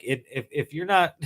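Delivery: ordinary delivery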